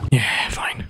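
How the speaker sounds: whispered shrug. Real, not acted. Shrugging off cooked salmon, whispered. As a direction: murmur it, unimpressed.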